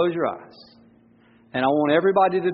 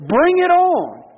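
put-on cocky young David; 4 semitones higher, slower than usual. Pastor acting David taunting the giant, high and cocky. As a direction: taunt like a fearless thirteen-year-old. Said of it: "he's saying".

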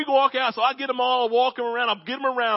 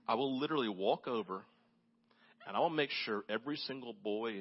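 much softer, low, calm promise; soft reassuring dad; 10 semitones lower, 13 dB quieter than usual. Retold or imitated. Dad promising scared girls he'll protect them, soft and reassuring.

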